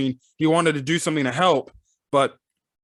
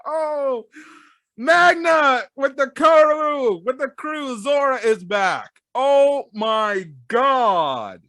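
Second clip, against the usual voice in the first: high-pitched amazed exclaiming; real excitement, voice pitched way up; excited reaction; 10 semitones higher, slower than usual. Real, not acted. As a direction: exclaim it high and thrilled, stretching the "Oh my God". Excited reaction to a returning character.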